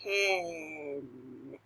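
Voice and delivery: Ten said smoothly as one word; blended word. Shown as a demonstration. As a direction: blend the sounds together. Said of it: "Blend them".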